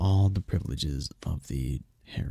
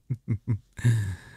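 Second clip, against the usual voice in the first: sly chuckle; 5 semitones higher, 3 dB louder than usual. Acted. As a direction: chuckle like a pleased predator. Demon chuckle after flirt.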